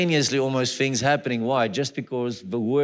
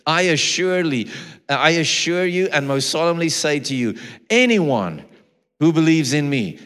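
louder, higher preaching of scripture; preaching at full force; 3 semitones higher, 5 dB louder than usual. Acted. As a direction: proclaim the verse with rising force.

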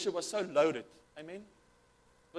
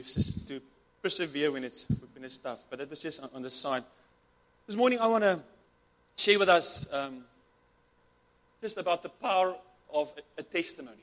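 normal talking voice

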